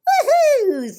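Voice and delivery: loud high whoop; happy yell. Acted. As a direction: cheer it at the top of your voice. Tacky cannonball cheer.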